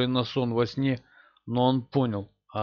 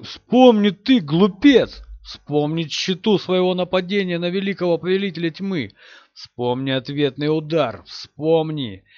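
screaming, much higher and louder; furious shout; 4 semitones higher, 5 dB louder than usual. Acted. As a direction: scream the command in rage. Villain screams at the hero.

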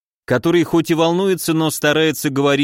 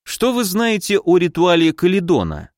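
plain reading voice

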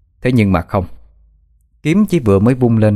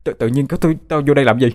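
out of breath; 3 semitones higher than usual. A narrator's reading. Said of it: "Nó thở dốc nói".

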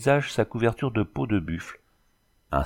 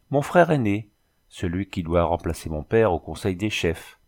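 ordinary delivery, nothing marked

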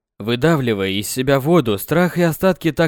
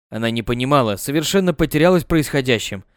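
ordinary delivery

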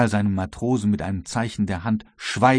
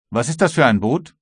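lightly voiced officer, raised and louder; questioning guard; 4 semitones higher, 5 dB louder than usual. A narrator's reading. Guard leader questions the captain about the boat, lightly voiced officer.

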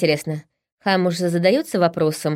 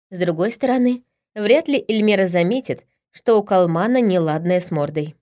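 plain reading voice

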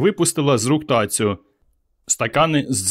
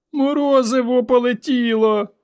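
put-on very high, slow child's wail; tearful girl calling after her ice cream; little girl crying out; 12 semitones higher than usual. A narrator's reading.

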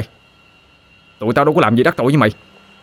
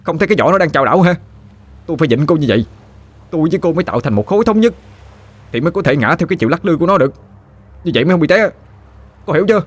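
rushed, higher, impatient explaining; a put-out lad lecturing her; exasperated; 8 semitones higher, faster than usual. A narrator's reading. Chớt explains the physics to Phương, rushed and exasperated. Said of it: "Thằng Chớt tặc lưỡi nói".

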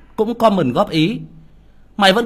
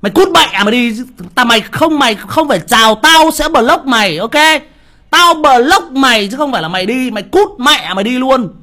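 louder and higher, threatening to block him; furious shout; 4 semitones higher, 5 dB louder than usual. Real, not acted. Streamer to a rude commenter, furious and loud. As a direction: bark the ban at him.

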